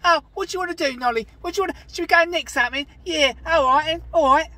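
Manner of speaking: high, loud, hammed-up Cockney kid; cheeky Cockney urchin. Acting a Cockney street urchin, high and loud. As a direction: play an Artful Dodger type, fast and cheeky.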